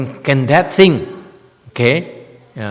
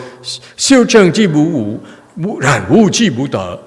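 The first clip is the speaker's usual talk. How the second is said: mock sutra quoting; 7 semitones higher, 8 dB louder than usual. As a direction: fire the phrase back like a smug quotation. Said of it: "I'm making fun of Chinese".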